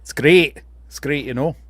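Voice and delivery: put-on Scottish, relishing the vowels; Scottish accent. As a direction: say it as a Scot would. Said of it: "It's a great Scottish accent".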